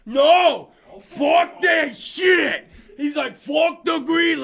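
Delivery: loud, high, dragged-out shouted refusal; furious coach. Retold or imitated. Hockey coach yelling back at a parent from the bench.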